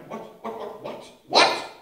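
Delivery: rising, getting loud "what, what"; astonished. Retold or imitated. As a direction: stammer it in disbelief. Martha's astonished reaction.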